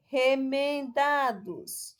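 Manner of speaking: Remendados slowly, syllable by syllable, DA held; stress on DA. Shown as a demonstration. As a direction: draw out Remendados, lengthening DA. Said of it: "a sílaba tônica". (Remendados bem devagar, alongando o DA)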